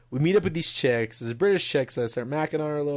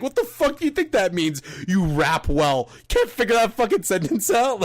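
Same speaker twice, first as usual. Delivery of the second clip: mocking; 6 semitones higher than usual. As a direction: jeer at him like a dumb kid in a high voice. Friends mock the guy together.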